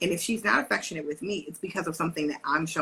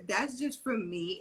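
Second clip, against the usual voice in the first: usual speaking voice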